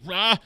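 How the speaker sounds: a sudden high startled yell. Retold or imitated. Man spotting crocodiles below, high startled yell. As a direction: jump and yelp at the crocodiles.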